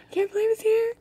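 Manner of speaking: very high, loud excited squeak; thrilled and squeaky; giddy excitement. Real, not acted. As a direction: squeal the words in delight. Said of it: "I'm so excited".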